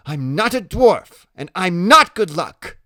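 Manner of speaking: high, loud, emphatic denial; indignant protest. Acted. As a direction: object hotly as the teased boy. Boy protests while ducking away.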